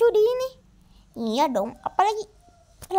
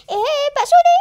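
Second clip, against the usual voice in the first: loud, high call to the waiter; 8 semitones higher, 9 dB louder than usual. Acted. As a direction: shout to get his attention. Calling the waiter. (memanggil Pak Sudi dengan keras)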